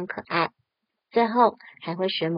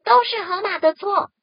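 高而响的群体怒喊; 一群动物一起冲着河马喊; 齐声吼叫; 10 semitones higher, 6 dB louder than usual. Acted. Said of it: "都生气的大声吼叫". (大声怒吼)

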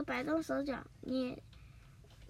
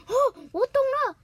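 much higher, loud, fast cartoon-robot voice; Doraemon realizing; 12 semitones higher, 12 dB louder, faster than usual. Doraemon catches on to Nobita's secret, high and loud. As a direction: squeal the sudden realization as Doraemon.

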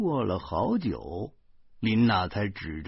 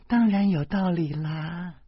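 patient wife; 9 semitones higher than usual. A narrator's reading. Wife patiently explains to child.

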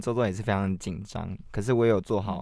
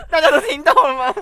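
laughing; 11 dB louder than usual. Real, not acted. Laughing after the ringtone.

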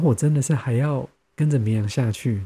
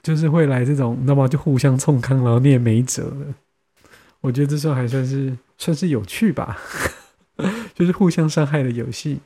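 ordinary talk

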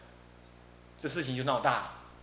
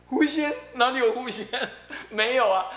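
high, loud disbelief; incredulous; 7 semitones higher, 5 dB louder than usual. Retold or imitated. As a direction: scoff at the idea of a fox spirit. Uncle disbelieving fox spirit claim.

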